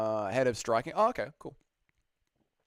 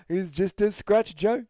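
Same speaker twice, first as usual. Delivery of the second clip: put-on higher, slow New Zealand accent shrug-off; Dan Hooker accent; 7 semitones higher, 5 dB louder, slower than usual. Fighter telling Joe it's a scratch, New Zealand accent. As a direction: say it like a Kiwi laughing off a wound. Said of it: "Can you do a Dan Hooker New Zealand accent?".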